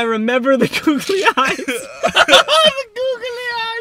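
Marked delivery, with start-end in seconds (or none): 1.6-3.8 loud, high, laughing through tears; laughing hard. Real, not acted. Actor remembering googly eyes, crying with laughter. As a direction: give in to helpless laughter. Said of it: "I laugh so hard".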